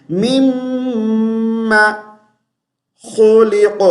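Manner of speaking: مما with a long hummed m and short final a; correct ghunna. Shown as a demonstration. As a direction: chant مما خلق slowly, ending خلق on a heavy q. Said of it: "لمبا نہیں کرنا".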